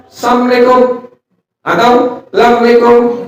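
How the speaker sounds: Assalamualaikum slurred as samlekum, lamlekum; caller's clipped salam; mock sloppy greeting. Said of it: "kalimat seperti itu salah".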